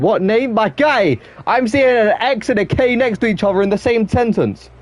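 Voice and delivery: high amazed disbelief; can't believe the spelling; incredulous outburst. Real, not acted. Streamer shocked at a name's spelling, incredulous.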